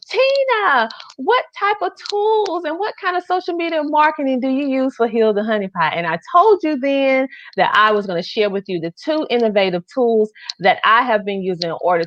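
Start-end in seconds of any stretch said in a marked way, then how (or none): 0.1-5.9 high, eager voice of asking fans; viewers asking. Retold or imitated. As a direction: play the curious viewer asking her. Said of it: "you have been asking me for months".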